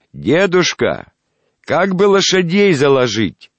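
loud, slow, high call. Acted. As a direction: speak up for a deaf old man. Narrator calls to deaf man.

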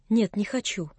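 soft, sullen no into the pillow; sullen refusal. A narrator's reading. Girl refusing the old man into her pillow, quiet and sullen. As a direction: refuse quietly without lifting your head. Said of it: "не подымая головы".

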